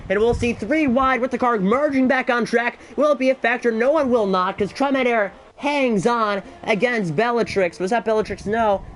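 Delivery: rising race call through the pit battle; excited play-by-play. Real, not acted. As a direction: call the wheel-to-wheel fight live. Live race play-by-play surge.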